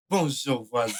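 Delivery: deep falling reply; low answering greeting. Shown as a demonstration. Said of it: "et on répond".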